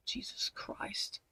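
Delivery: breathy exasperated whisper under the breath; slow whispered groan of disbelief. Real, not acted. Reading an infuriating article, she whispers slowly.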